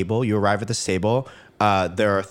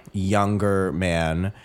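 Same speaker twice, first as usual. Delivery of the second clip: normal talking voice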